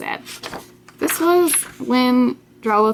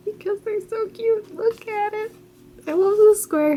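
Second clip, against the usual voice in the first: cute voice; 12 semitones higher than usual. Real, not acted. Cooing over cute owl drawing.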